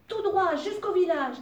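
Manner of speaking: high, loud shout of happiness; joyful cry. A narrator's reading. Fairy riding the wolf toward the village, joyful shout. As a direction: call out the way in a burst of joy. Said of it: "cria-t-elle dans un accès de bonheur".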